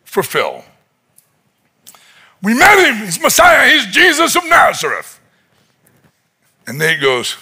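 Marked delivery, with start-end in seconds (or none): 2.4-5.2 high, eager announcement; all excited. Retold or imitated. Phil telling an unwilling Nate of the Messiah, excited. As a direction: blurt out the big news. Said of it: "he's all excited".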